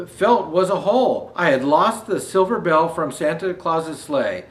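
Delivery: gentle read-aloud storytelling; story narration. A narrator's reading. Reader sharing the book with listeners, storytelling voice. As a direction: read the picture book to the audience.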